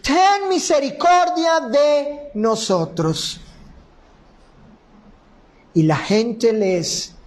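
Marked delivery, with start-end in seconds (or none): 0.0-3.4 imploring call, pitched up; crying out. Acted. Blind men beg Jesus for mercy, crying out. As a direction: call out for mercy, drawing out the plea. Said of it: "clamaron diciendo".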